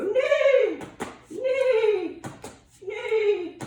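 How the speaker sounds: very loud, high whinny; horse neigh. Shown as a demonstration. Yoga teacher showing kids the horse, loud neighing. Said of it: "get like this and go".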